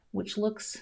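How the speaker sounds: ordinary delivery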